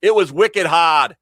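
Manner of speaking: "wicked hard" in a thick Rhode Island accent, slow and loud; broad New England Rhode Island twang. Shown as a demonstration. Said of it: "get that accent out of my system".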